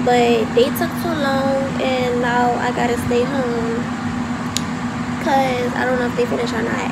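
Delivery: loud, fast, so the phone hears; talking loud. Real, not acted. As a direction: raise the voice over the noise. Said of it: "but I'ma talk loud".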